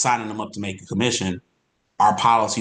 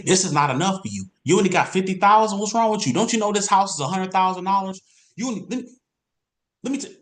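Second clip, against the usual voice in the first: high, scolding, pushy sales pitch; pushy salesman; 9 semitones higher than usual. Shown as a demonstration. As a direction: lecture the client like she's foolish. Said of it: "when you start talking like that".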